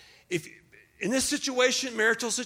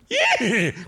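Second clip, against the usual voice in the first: loud, high imitated neigh; whinny like a horse; sudden comic horse noise; 3 semitones higher, 5 dB louder than usual. Imitates horse while explaining the bit.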